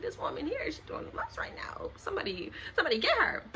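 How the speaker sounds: breathy whispered aside to onlookers; mock whisper about the woman. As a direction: whisper it behind her back.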